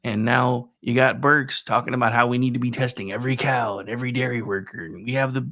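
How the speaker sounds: usual speaking voice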